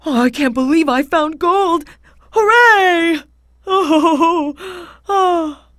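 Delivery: high, jubilant cheer, loudest on Hooray; overjoyed. A narrator's reading. Husband who found gold on the mountain, overjoyed. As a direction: whoop with joy at a lucky find. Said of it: "The husband was so happy".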